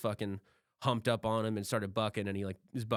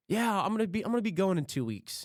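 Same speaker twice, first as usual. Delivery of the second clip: mock polite notice; 7 semitones higher than usual. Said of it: "you don't ever, like".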